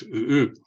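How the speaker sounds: "ooh, ooh" with a glottal catch after the first "ooh"; owl call with a glottal stop. Shown as a demonstration. Teacher showing the glottal stop in the owl name. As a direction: cut the first "ooh" off in the throat, then hoot again. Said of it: "There's a glottal stop after the first U".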